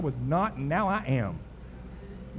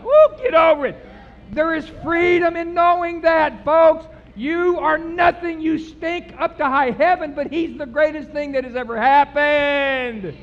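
high, loud, slow preacher's shout; full-force preaching; 7 semitones higher, 8 dB louder, slower than usual. Real, not acted. Preacher exhorts folks about freedom, full-force preaching. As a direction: proclaim it at full force, drawn out.

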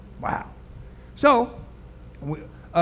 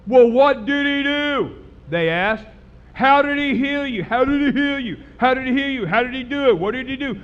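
acted interrogation; 4 semitones higher, 7 dB louder than usual. Crowd interrogates the healed man.

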